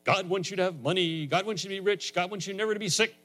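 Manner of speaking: high, insistent prosperity-preacher imitation. Preacher imitates television evangelists promising money, high and insistent. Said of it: "The people that go on television and say".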